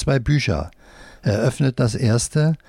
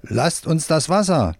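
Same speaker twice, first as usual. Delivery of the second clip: mild narrated shout, higher and slow; shouting workman; 6 semitones higher than usual. A narrator's reading. Workman calls children off the water, mild storyteller's shout. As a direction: call out slowly without real volume. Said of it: "bis ein Arbeiter schreit".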